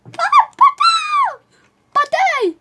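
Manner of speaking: high-pitched squawked cry; loud high yelps; startled shriek. Real, not acted. Startled by the game.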